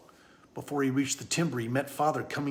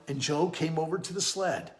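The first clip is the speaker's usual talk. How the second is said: ordinary delivery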